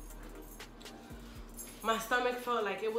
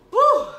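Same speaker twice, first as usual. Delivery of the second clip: loud high whoop at burning spice; spicy food whoop; 18 dB louder than usual. Real, not acted. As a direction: shout it out, mouth on fire. Burning mouth from spicy challenge.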